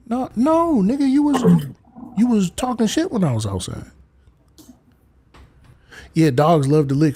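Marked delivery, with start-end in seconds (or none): 0.1-3.9 much higher voice for the sassy dog; dog's voice. Retold or imitated. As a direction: voice the dog calling him out. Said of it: "He gon'".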